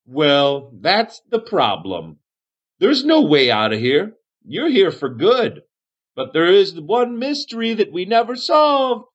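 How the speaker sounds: high, swooping voice of an odd survivor; Aniston, dotty cave hermit. Acted.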